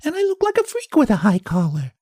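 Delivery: high, teasing and cheerful; laughing off the feud with sweet malice; good-naturedly. Acted. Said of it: "she said good-naturedly".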